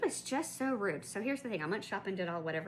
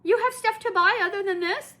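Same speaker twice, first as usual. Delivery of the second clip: high, loud snooty put-on lady voice; nosy lady voice, pitched way up; mocking rude lady; 13 semitones higher, 11 dB louder than usual. Rude shopper questions her at register, high mocking voice. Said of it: "rude lady says".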